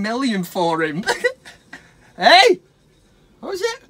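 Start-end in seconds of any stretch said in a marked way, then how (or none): none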